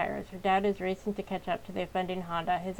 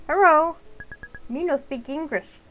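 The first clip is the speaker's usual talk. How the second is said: mock broken English; 7 semitones higher, 7 dB louder than usual. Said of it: "I don't sound like that".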